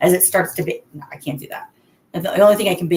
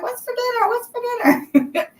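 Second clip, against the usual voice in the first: put-on much higher, squeaky child voice, second ask slow and drawn out; kids asking for dinner. Kids running through the kitchen asking their mom, squeaky child voice. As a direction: ham up impatient little kids nagging. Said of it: "they're running through the kitchen like".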